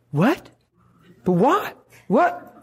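baffled questions climbing upward; disbelieving protest to God; stunned disbelief. Retold or imitated. Stunned reply to the Lord.

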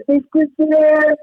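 imitated children, high drawn-out cry; kids' outcry. Pupils reacting to teacher breaking the light, high outcry. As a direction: cry out slowly like a crowd of kids.

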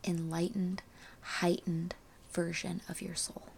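soft hushed spiritual voice; quiet and airy, almost a whisper; airy breathy mystical healer. Acted. Closing soul message.

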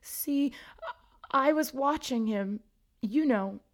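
high plaintive ghost explaining; mournful Myrtle. A narrator's reading. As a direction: mourn as you tell it. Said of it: "she explained mournfully".

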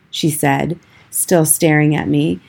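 plain reading voice

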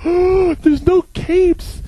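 gushing admirer. Retold or imitated. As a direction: gasp and swoon over it. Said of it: "they just were like".

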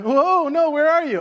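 high, loud mock alarm; panicked child. Child on bike calling to parent, mock panic. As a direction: imitate a kid calling out in panic.

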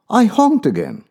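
ordinary delivery, nothing marked

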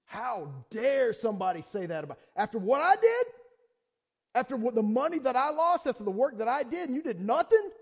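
loud, high indignant rant; shouted outrage, pitch climbing high; angry outburst. Retold or imitated. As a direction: relive the fury at being accused. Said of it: "But I was mad".

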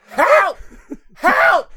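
high, loud cartoon cry for help; mock screaming. Podcast host voicing tiny doomed sperm, mock screaming. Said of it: "They're all just screaming".

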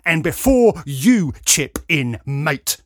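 loud, slow, jabbed-out words; shouting word by word. A narrator's reading. Lout jabbing finger in man's chest, shouting. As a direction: stab out each word. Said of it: "he shouted, stabbing me with each word".

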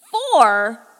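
loud outraged disbelief; incredulous shout. Acted. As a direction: explode at the shocking answer. Leader explodes at the tiny number.

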